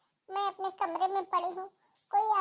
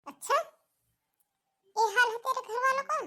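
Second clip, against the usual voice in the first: higher-pitched, rising indignant question; affronted on a daughter's behalf; acted indignation; 6 semitones higher, 3 dB louder than usual. Mother hearing how in-laws treat her daughter, indignant.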